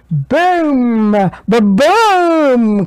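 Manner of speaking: loud booming thunder sound. A narrator's reading. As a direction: boom it out like thunder.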